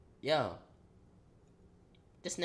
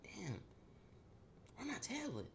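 quiet, higher puzzled mutter; searching the room, muttering to himself; 7 semitones higher, 17 dB quieter than usual. Retold or imitated. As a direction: wonder aloud under your breath.

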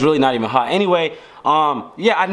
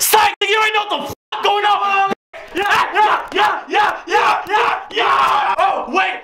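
excited shout; slower than usual. Real, not acted. Prank reveal after fake sad.

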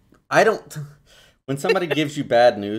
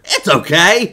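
louder, rising awkward it's okay; awkward reassurance; 5 semitones higher, 9 dB louder, slower than usual. Retold or imitated. Son mocking the awkward reply to sympathy, bright and uneasy. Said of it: "without that awkward".

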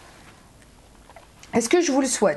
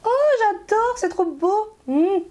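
much higher, gushing over the colour; delighted; 10 semitones higher than usual. Real, not acted. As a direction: squeal with pleasure at the shade. Delight on seeing the lipstick. (ravie, voix très aiguë)

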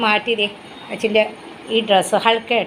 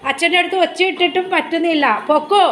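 pet talk; 7 semitones higher than usual. Real, not acted. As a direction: owner cooing to her dog that wants to go out. Talking to dog.

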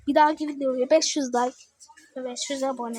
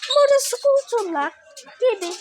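excited; 10 semitones higher, 6 dB louder than usual. Real, not acted. Kid spots scooters excitedly.

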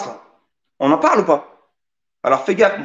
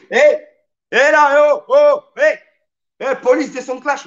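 calling out; 6 semitones higher, 4 dB louder than usual. Pretend. Calling out the police.

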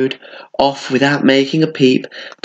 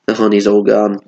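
ordinary delivery

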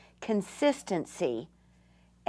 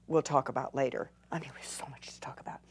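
rushed, much softer whisper; fast whispered aside; 6 semitones lower, 9 dB quieter, much faster than usual. Real, not acted. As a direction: toss it off under your breath. Aside while demonstrating.

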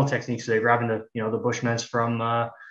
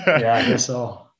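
laughing; 6 dB louder, slower than usual. Real, not acted. Laughing reply to a covid joke.

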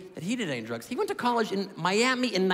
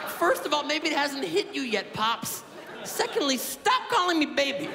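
higher, laughing protest; half-laughing higher protest; rattled son; 7 semitones higher than usual. Retold or imitated. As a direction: push back high and flustered. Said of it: "I was like".

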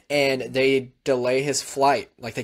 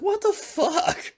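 much higher, slow, stretched out in disbelief; incredulous outburst; much slower than usual. Retold or imitated. Father at the gate after the flight left, incredulous. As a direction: drag it out like you can't believe it.

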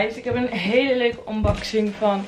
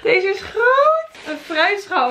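surprised; 10 semitones higher, 4 dB louder, slower than usual. Real, not acted. Surprised at huge fruit bowl.

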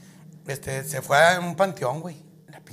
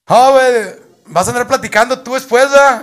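shouted, much louder scolding; loud accusation; 9 semitones higher, 18 dB louder than usual. Acted. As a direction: yell it like a threat. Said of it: "le decía". (regaño a gritos)

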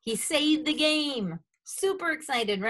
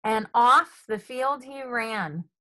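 ordinary delivery

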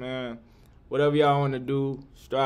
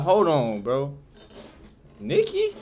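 acted disbelief; slower than usual. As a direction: act out a startled second look. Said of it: "You gonna be like".

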